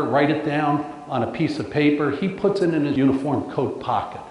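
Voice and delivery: ordinary delivery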